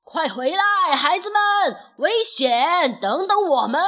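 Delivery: urgent calling after runaways; loud shout. A narrator's reading. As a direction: call them back across a distance at full voice. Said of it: "大声喊道". (大声急切地呼喊)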